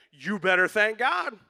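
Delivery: high, loud, scolding order; stern demand. Pretend. Preacher mocking a pushy command, high and loud. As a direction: scold the congregation into thanking God. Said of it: "The psalm does not say".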